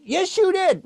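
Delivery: high-pitched, insistent comeback; childish high-pitched contradiction; squabbling retort. Acted. Back-and-forth squabble with the puppet.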